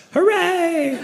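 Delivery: high, bright little hooray; mock cheer. Comic cheering the party news, high mock hooray. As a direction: cheer it like a kid.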